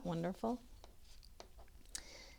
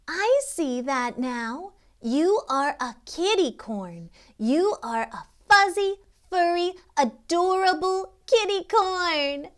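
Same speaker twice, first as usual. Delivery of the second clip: louder, higher, building joyful gush; delighted; 10 semitones higher, 9 dB louder than usual. A narrator's reading. Kitty rejoicing to the unicorn, building excited gush.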